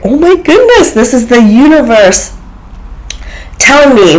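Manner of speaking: high, delighted squeal of realisation; thrilled. Real, not acted. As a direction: soar up with sudden joy. Thrilled inner thought about sign.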